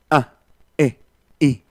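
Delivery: "A, E, I" each started with a glottal catch; glottal onsets. Shown as a demonstration. Teacher demonstrates glottal stops on vowels for the class. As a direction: click each vowel open from the throat. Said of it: "eso que estoy haciendo aquí en la glotis".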